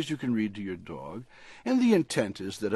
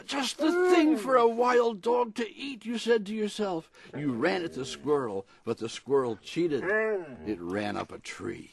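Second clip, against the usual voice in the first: lively picture-book read-aloud; storybook reading; 6 semitones higher than usual. A narrator's reading. Author reading his dog story on the radio, storybook voice. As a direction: read it like a bedtime story for a pet.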